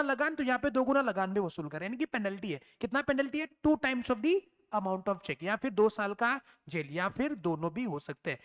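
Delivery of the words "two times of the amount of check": normal talking voice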